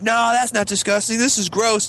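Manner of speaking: high-pitched daredevil retort; mock defiant boast. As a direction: show off with a gross dare. Imitated Bailey daring the girl.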